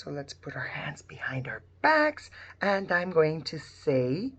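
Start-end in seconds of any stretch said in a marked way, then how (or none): 0.0-1.6 1 whispering. Real, not acted. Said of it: "secret fingers".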